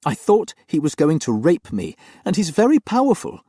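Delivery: put-on high, loud young woman's plea; frightened young nanny. Nanny explains her fear to her master, high and earnest.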